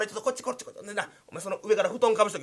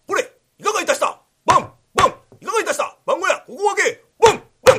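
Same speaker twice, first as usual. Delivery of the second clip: much higher and louder, fast commanding yells; samurai shouting; 10 semitones higher, 10 dB louder, faster than usual. Acted. Patrolling samurai pounding on the hut door, shouting. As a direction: hammer at the door and demand an answer. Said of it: "見回りのお侍さん".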